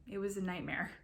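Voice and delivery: plain reading voice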